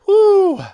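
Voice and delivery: high, loud "whoo"; one sharp, loud whoo of excitement; excited whoop. Real, not acted. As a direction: let out a high-pitched whoop. Reacting to the tense story.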